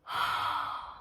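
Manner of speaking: soft breathy sigh, overwhelmed; quiet overwhelmed exhale; emotional sigh. Real, not acted. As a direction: let out a moved sigh. Overcome by memory of song.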